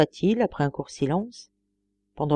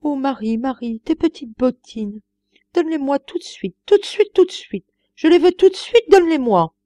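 put-on high, pleading like a whining child, building louder; crying child's voice; 7 semitones higher, 5 dB louder than usual. Old master begging the maid for her boots, childish whining cry. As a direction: beg for the boots like a wailing child. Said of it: "d'une voix d'enfant qui pleure".

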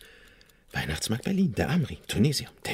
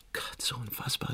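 awed whisper; 4 semitones higher, 11 dB quieter than usual. Acted. Schwärmt geflüstert von den Leuten.

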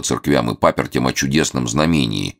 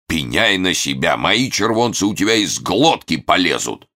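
gruff threat; 4 semitones higher than usual. Acted. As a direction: bark it suspiciously. Suspicious merchant threatening.

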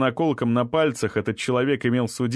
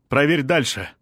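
calling out; 3 semitones higher, 3 dB louder than usual. A narrator's reading. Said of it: "крикнул он пограничнику".

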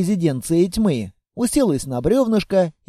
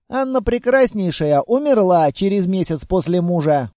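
ordinary delivery

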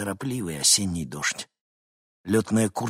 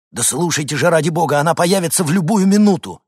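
loud, high, insistent plea; exasperated urgency; 9 semitones higher, 10 dB louder than usual. Acted. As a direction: beg her to listen, loudly. Мужчина отчаянно убеждает женщину. (громко, отчаянно убеждая)

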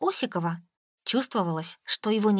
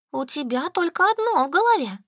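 teasing; 9 semitones higher, 4 dB louder than usual. A narrator's reading. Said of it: "поддела Солька".

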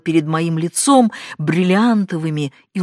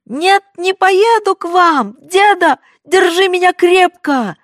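desperate cry; 10 dB louder than usual. A narrator's reading. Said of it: "крикнула отчаянно".

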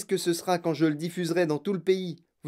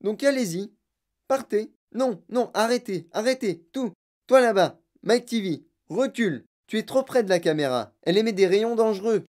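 high-pitched, agitated calls; raised voice of alarm; urgent warning; 6 semitones higher, 4 dB louder than usual. A narrator's reading. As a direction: stop them and warn the boy off, urgently. Frantic warning to stop, stand back.

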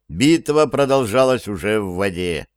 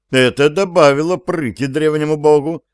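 ordinary talk, nothing marked

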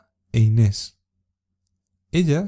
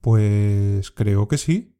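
very slow, hesitant "Pues creo que sí.", dragging the "Pues"; unsure, stretching each word while thinking; doubtful; 2 semitones lower, much slower than usual. Shown as a demonstration. Teacher modelling doubt for learners, very slow and hesitant. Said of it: "cuando tiene el significado de duda".

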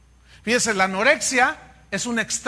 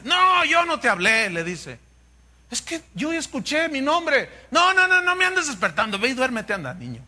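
put-on annoyed old priest; 6 semitones higher than usual. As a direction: shoo the boy back to bed. Elí regaña a Samuel. (Elí regañando al niño, más alto y fuerte)

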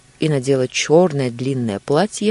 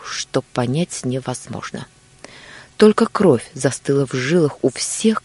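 ordinary delivery, nothing marked

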